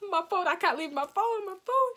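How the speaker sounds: high, loud dramatised panic; panicked protest. Retold or imitated. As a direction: wail it in mock desperation, rising at the end. Protesting at abandoning her phone.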